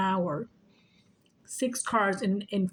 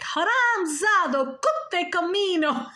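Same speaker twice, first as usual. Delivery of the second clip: slow, higher mock cursing as dad; imitating dad cursing; 9 semitones higher, 4 dB louder, slower than usual. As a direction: drag out the Hindi curses, half-laughing. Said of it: "cursing in Indian".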